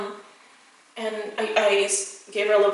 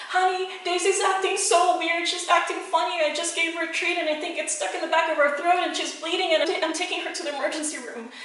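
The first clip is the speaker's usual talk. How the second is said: higher, worried retold phone plea; a mild mock of her own panic, pitched higher; retold panicked call; 7 semitones higher than usual. Wife phoning her husband about the bleeding dog, higher worried voice.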